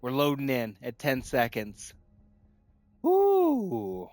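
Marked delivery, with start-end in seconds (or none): 3.0-4.1 excited whoop. Real, not acted. Loading into new match.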